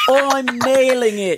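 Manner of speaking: high, hammed-up Australian boast; Australian accent. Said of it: "Read 'em in your Australian accent".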